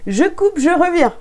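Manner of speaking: high-pitched, giving up to the giggles; helpless laughter. Real, not acted. Reader cutting the recording as she cracks up, laughing. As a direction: squeak out the words while losing it. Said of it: "je vais pas y arriver".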